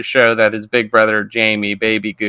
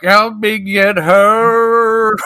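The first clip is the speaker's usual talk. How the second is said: very slow, high eerie moan; creepy ghost plea; 9 semitones higher, much slower than usual. Retold or imitated. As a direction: stretch it out like a haunting. Ghost appears right in front.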